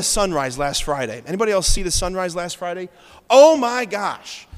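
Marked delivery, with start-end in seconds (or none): 3.3-4.5 slow, loud burst of wonder; awed exclamation. Real, not acted. Preacher recalls the sunrise, loud and slow in awe. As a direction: gasp it out at the beauty.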